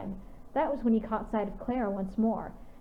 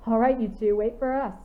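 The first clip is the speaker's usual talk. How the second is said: louder, cheerful call after them; laughing shout; 5 dB louder than usual. A narrator's reading. Eric calling after the wolves ahead, laughing shout. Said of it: "Eric shouted with a laugh".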